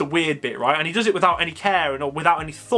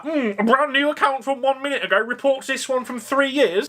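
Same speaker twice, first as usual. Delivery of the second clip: higher, mock-pondering moderator voice; a hammed-up YouTube staffer weighing a report, higher; 6 semitones higher than usual. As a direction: play a sniffy YouTube reviewer. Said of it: "What do you think YouTube are gonna do".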